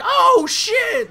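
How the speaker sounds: very high, loud mock shriek; startled moviegoers. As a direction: yell it like a startled audience member.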